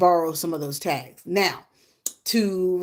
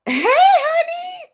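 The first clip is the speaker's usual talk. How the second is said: high, loud, slow sing-song hey; shouted greeting; 8 dB louder, much slower than usual. Real, not acted. To a live viewer, high drawn-out shouted hello. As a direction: call out to a friend across the room.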